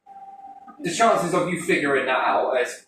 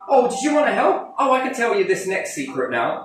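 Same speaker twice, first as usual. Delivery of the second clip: mock teasing voice; 7 semitones higher than usual. Acting out a teasing dialogue.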